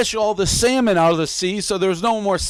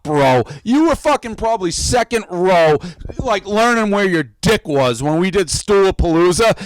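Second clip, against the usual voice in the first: usual speaking voice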